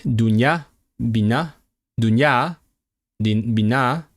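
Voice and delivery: "Dunya", "bina" with the final syllable stressed and rising; each of "Dunya" and "bina" lifted at the end; last-syllable stress. Shown as a demonstration. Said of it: "Stress the last syllable".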